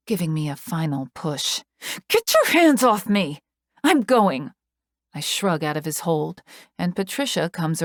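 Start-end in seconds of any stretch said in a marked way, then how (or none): 2.1-4.5 high, louder shout; angry shout. A narrator's reading. Shouting at Joker to let go, angry. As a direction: shove the words at him.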